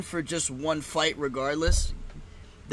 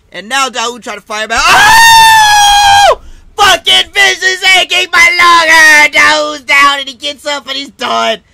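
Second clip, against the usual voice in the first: screaming fight commentary, far louder and higher; knockout call; 12 semitones higher, 19 dB louder, slower than usual. Real, not acted. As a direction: explode into a very high yell. Calling a vicious knockout live.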